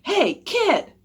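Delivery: loud, high call across a field; high, punchy holler; coach calling out. A narrator's reading. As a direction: call out to get a kid's attention. Coach calls out to Jack.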